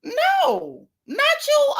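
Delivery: very high-pitched mock-wailing squeal; mock disbelief. YouTuber ridiculing Necrosis for acting offended, high mock squeal.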